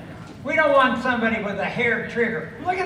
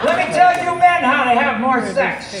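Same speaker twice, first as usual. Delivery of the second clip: ordinary talk, nothing marked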